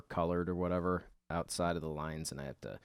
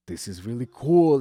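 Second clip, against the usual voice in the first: mock dad enthusiasm; 4 semitones higher, 10 dB louder than usual. Dad faking excitement about his son's belt, mock cheerful. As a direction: overplay the dad's delight at the belt. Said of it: "and he's like".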